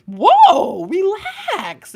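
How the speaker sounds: high, loud "whoa, relax"; mock calming. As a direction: wave someone down, exaggerated. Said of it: "And it's like".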